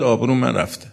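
usual speaking voice

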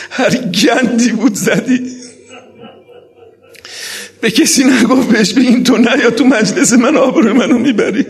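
very high, loud lamenting cry; impassioned tearful preaching. Acted. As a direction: preach at full force, nearly wailing. Preacher's climactic tearful exhortation.

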